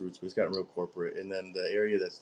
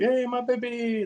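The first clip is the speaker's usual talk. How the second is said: put-on much higher, slow, sing-song hey my baby; mock vendor greeting; 4 dB louder, slower than usual. Imitating a booth vendor greeting him, high and drawn out. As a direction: coax a buyer like a pushy stall seller.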